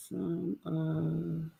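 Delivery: ordinary talk, nothing marked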